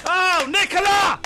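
high, exuberant shout; elated gushing. Real, not acted. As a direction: cry out in overjoyed praise. Gushing over the travel reader.

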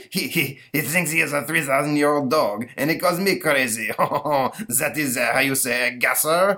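Frenchman giggling through the words; laughing French accent. Acted. Napoleon laughs at the monster.

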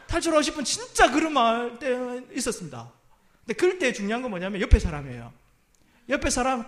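ordinary delivery

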